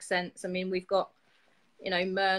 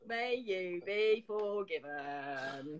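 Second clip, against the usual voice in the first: very slow, drawn-out mock pious blessing; mock solemn blessing; 2 semitones lower, 6 dB quieter, much slower than usual. Teasing co-host slowly intoning a mock blessing at her. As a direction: stretch it out like a priest, tongue in cheek.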